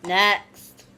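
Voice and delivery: ordinary delivery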